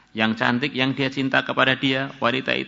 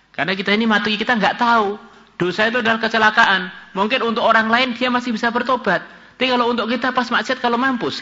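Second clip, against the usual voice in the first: high-pitched, insistent warning; urgent preaching; 10 semitones higher than usual. Real, not acted. Preacher warning listeners about dying in sin, high urgent preaching. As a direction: press the warning home in a raised voice.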